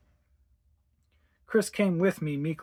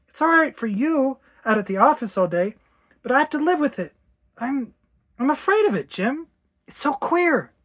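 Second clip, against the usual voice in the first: high-pitched, fearful complaint; frightened outburst; 9 semitones higher, 5 dB louder than usual. Acted. Wife admitting fear to husband, high and anxious. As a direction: confess the fear in a high, strained plea.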